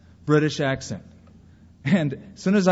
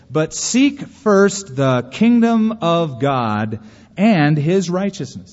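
slow, loud, weighty delivery; deliberate, ringing declaration of scripture; emphatic scripture quote; 4 dB louder, much slower than usual. Shown as a demonstration. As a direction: hammer out the verse slowly, word by word. Quoting Jesus's words with emphasis.

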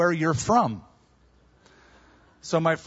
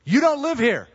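higher, emphatic self-correction; mock self-scolding; 9 semitones higher, 4 dB louder than usual. Preacher catching himself redesigning someone else's house, mock scolding. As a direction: pull yourself up short, pitched high.

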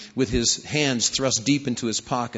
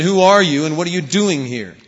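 much louder, angry repeat of the challenge; louder bark; 3 semitones higher, 9 dB louder than usual. A narrator's reading. Soldier repeats his challenge to the rabbi, furious shout. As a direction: shout it now, losing patience. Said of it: "the bark of a Russian soldier".

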